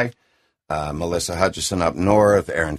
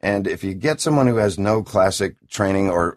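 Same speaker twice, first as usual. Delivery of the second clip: ordinary talk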